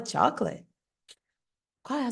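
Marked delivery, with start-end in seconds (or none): 0.0-0.7 chocolate as two-syllable CHAWK-luht; English pronunciation. Shown as a demonstration. Teacher quotes the word to guess, two-syllable English chocolate. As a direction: say chocolate the English way, middle swallowed. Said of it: "que se fala".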